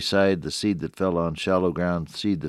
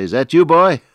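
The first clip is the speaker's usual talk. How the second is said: put-on loud, high call of an old father; 9 semitones higher, 10 dB louder than usual. Blind father hears his son at the doorway, calling out. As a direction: call out to check who is there.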